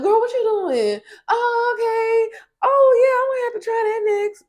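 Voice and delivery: very high, loud fake-friendly sing-song; fake and phony. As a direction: gush sweetly, falsely. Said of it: "Real fake and phony".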